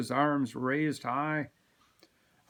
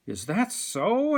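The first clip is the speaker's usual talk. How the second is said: higher, slow, amused question; a hint of a cheerful baker; friendly shopkeeper; 8 semitones higher, 4 dB louder, slower than usual. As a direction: play the pleased sweet-shop owner. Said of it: "asks Mister J".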